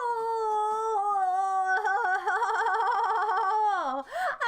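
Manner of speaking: long high sung-out squeal of joy; ecstatic shrieking laugh. Real, not acted. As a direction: cry out with laughter in triumph. Peak of celebration.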